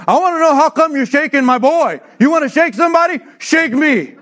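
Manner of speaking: put-on a father squaring up for his boy; angry dad. Dad confronting the teacher.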